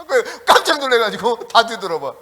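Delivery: amused, laughing retelling; chuckling as the words come out. Real, not acted. Laughing after his imitation.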